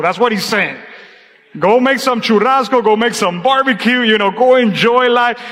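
normal talking voice

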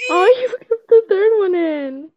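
high, slow delighted cry; drawn-out happy squeal; excited cheer. Real, not acted. Relief at video.

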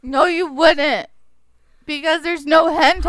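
loud. Real, not acted. Loud bickering.

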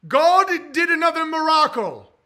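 miracle said as mur-ah-co, high and loud; high, loud sermon-style miracle; loud showcase of his pronunciation. Shown as a demonstration. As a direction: proclaim God did another miracle, miracle as mur-ah-co. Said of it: "What else do I say weird?".

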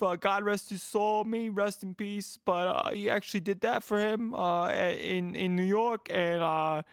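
put-on shitty Northeastern accent. Husband telling his coffee-shop story, hammed-up Northeastern accent. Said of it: "of this Northeastern person".

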